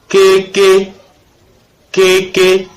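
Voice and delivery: "ke, ke" with the first E drawn out; long vowel example. Shown as a demonstration. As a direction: model "ke, ke" slowly with a long E. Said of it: "qui prolongent la tonalité".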